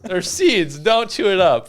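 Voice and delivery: high, loud warning; excited disgust. Real, not acted. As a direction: shout it out, grossed out. Reacting to chewing cranberry.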